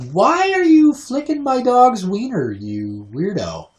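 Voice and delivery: high, loud drawn-out disbelief, dropping at the end; hammed-up incredulous protest; mock outrage. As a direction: squeal the question, then slow down and drop. Retold outraged reaction to flick.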